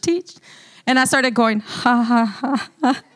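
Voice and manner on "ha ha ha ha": forced laugh. Retold or imitated. Speaker showing congregation how she tried laughing, forced. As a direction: spell out a fake laugh, feeling stupid. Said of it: "I felt so stupid".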